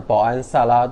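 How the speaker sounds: ordinary talk, nothing marked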